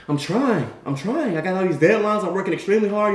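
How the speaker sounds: high-pitched, stressed protest; pleading. Acted. Susie warned by her boss, pleading.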